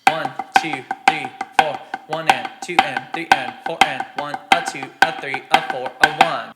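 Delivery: loud, rhythmic count with added syllables. Shown as a demonstration. Drum teacher counting off accents aloud, loud and rhythmic. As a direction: punch out each beat and syllable in strict time. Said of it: "or add the syllable to that you're doing".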